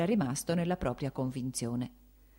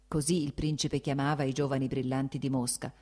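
plain reading voice

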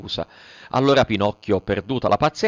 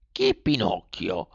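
slow, soft snail puzzlement; the snail asks again, unhurried; 4 semitones lower, 4 dB quieter, slower than usual. A narrator's reading. (lumaca lenta)

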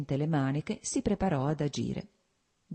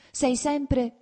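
high, loud start of a scolding; reproachful; 11 semitones higher, 5 dB louder than usual. A narrator's reading. Wife starting in on her husband, high and reproachful. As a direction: launch a reproach, pitched well up. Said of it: "cominciò a rimproverarlo".

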